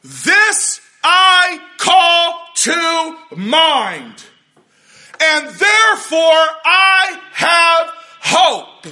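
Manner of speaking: preacher proclaiming the verse to the congregation, loud and slow; slow preached proclamation. Shown as a demonstration. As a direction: proclaim each word slowly, loud and heavy. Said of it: "underline it, highlight it".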